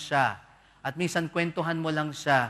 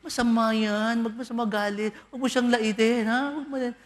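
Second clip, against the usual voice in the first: higher, pious scolding; 6 semitones higher, 3 dB louder than usual. Retold or imitated. Churchgoer scolding the angry patient. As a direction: wag a finger at them, voice raised. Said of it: "Sasabihin mo naman".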